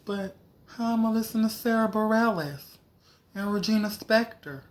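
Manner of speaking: high, pleading protest; whiny high protest. Retold or imitated. Protesting to her sister in a high whine. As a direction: whine about losing the app. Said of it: "I was like".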